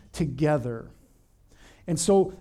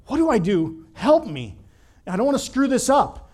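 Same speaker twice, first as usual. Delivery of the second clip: louder, higher mock-panicked begging; retold scared plea; 6 semitones higher, 6 dB louder than usual. New communion leader calling his mentor, mock-panicked plea. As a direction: plead loudly, hamming up the nerves. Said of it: "I was scared out of my shoes".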